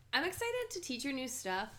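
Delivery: ordinary talk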